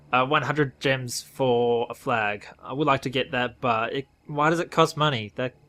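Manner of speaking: normal talking voice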